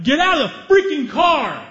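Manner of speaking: loud, high furious yell; explosive acted road-rage shout; pissed-off shout. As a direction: yell it as an enraged order. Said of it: "and he's pissed".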